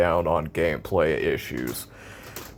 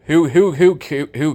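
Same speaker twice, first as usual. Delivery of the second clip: sudden loud exasperated outburst; wild unhinged ranting; 8 semitones higher, 6 dB louder than usual. Real, not acted. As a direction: blurt it out high and loud. Exasperated outburst at game feature.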